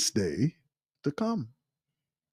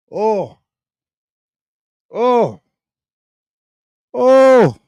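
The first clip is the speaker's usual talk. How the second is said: each oh higher and louder, rising to a cry; building exclamation; 9 semitones higher, 11 dB louder, much slower than usual. Real, not acted. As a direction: let the ohs swell into an outcry.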